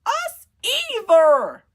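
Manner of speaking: very high, drawn-out group complaint; loud agreement. A narrator's reading. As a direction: chime in loudly, stretching the words. The rest echo Sophia's complaint.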